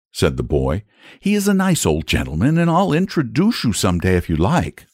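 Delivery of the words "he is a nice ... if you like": put-on high-pitched boy, offering politely; friendly boy. A narrator's reading. Boy figure in a story.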